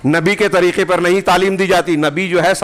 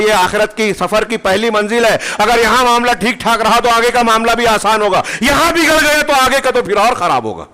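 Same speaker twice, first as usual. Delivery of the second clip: raised, high-pitched sermon voice; impassioned preaching; 8 semitones higher than usual. Acted. Preacher warning of the grave as first stage, impassioned. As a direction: preach it forcefully, climbing higher to a warning.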